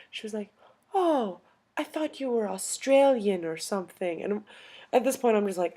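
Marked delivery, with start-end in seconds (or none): 0.9-4.2 higher-pitched imitation of an old European lady. Old lady guessing where they're from, imitated, higher-pitched. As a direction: play the surprised little old lady. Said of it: "little old lady comes up to us".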